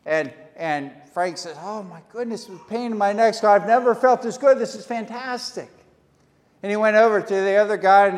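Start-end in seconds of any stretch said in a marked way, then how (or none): 1.6-5.7 high, loud excited cry; hammed-up delighted old man; overjoyed. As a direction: gush with joy. Frank healed, overjoyed.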